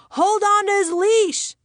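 high, loud scream of an order. Acted. House sitter to imaginary dad about the dog, screaming. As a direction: scream it out as an urgent command. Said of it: "I screamed".

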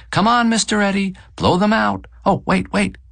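high-pitched urging call; wife yelling. A narrator's reading. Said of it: "she yells now from the sheet cake".

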